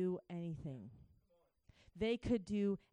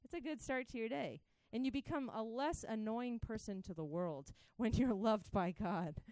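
normal talking voice

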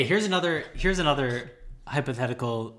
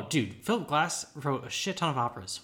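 ordinary talk, nothing marked

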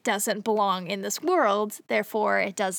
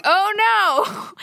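dismayed oh no; 10 semitones higher, 5 dB louder than usual. Retold or imitated. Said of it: "And he's like".

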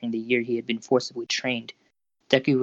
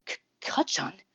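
stammered, higher-pitched quiet gasp; 8 semitones higher, 4 dB quieter, slower than usual. A narrator's reading. Boy finds an unexpected classmate at the door, gasping quietly. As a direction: gasp the name, catching on the first sound. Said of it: "Izuku gasped quietly".

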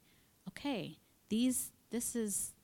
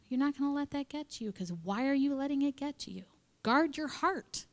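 firm coaching; 6 semitones higher than usual. Acted. Voicing God's corrective coaching.

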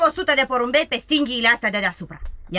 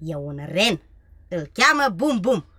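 ordinary talk

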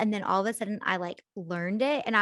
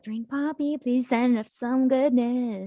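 pleading appeal; 3 semitones higher, 4 dB louder, slower than usual. Pretend. Playful appeal to a brand.

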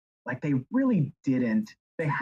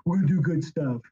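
Trump impression; 4 semitones higher, 4 dB louder than usual. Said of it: "don't give me your Trumpy answer".

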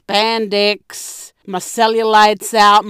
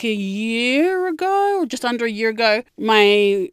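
usual speaking voice

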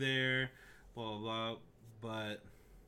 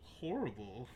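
softer, put-upon horrified muttering; mock disgust; 5 dB quieter than usual. Imagined listener to someone with bad breath, mock disgust. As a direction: play appalled by someone's breath.

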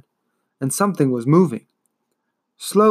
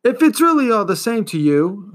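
higher, louder argument; boy arguing with the stubborn turtle; 7 semitones higher, 4 dB louder than usual. Acted. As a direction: argue the point back. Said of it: "Atreyu argued".

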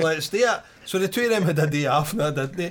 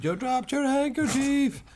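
high, slow mock call; imitated heckle, stretched out; retold shout; 9 semitones higher, much slower than usual. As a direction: call it out high, without real volume. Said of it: "and shouted".

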